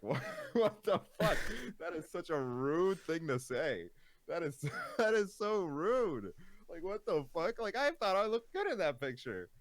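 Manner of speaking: high-pitched, incredulous protest through laughter; laughing disbelief. Retold or imitated. Streamer retelling a reaction to a rude DM, laughing disbelief. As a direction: squeal it in amused outrage, laughing.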